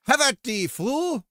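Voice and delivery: high, loud mock duelist challenge; bold swashbuckling bravado. Fighting rabbits in the game.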